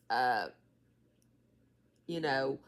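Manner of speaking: ordinary talk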